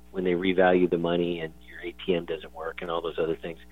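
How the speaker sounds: normal talking voice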